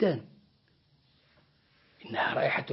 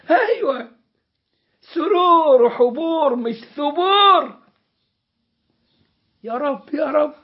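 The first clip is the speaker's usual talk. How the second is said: overjoyed exclaiming; 8 semitones higher, 6 dB louder than usual. Acted. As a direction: marvel out loud at what you see. Servant sees paradise's delights.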